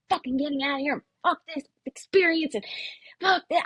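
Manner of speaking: cursing like a banshee. Retold or imitated. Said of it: "cursing like a freaking banshee".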